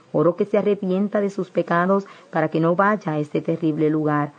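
ordinary delivery

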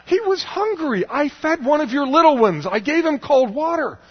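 loud, high hammed-up complaint; outraged protest. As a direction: plead your case at full shout. Mock outraged protest to God.

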